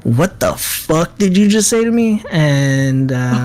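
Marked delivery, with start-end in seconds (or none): 0.0-2.2 outraged disbelief. Acted. Flabbergasted Garland confronts.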